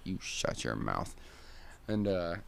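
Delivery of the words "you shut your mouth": grumpy mole. Acted. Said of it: "mole gets up and he's like".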